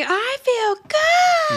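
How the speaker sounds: put-on high, drawn-out drunken scream; screaming I feel good. Drunk Angela screaming on the coffee table, high and loud. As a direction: belt it out like a party anthem.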